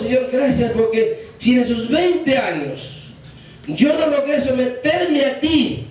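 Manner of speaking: high, slow, drawn-out pulpit prayer; impassioned preacher leading a vow; fervent prayer appeal. Real, not acted. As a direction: stretch the phrases in earnest surrender. Leads congregation in a prayer.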